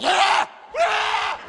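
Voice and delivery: put-on high shrieking child screams; kids screaming. Demonic kids shrieking at the nanny.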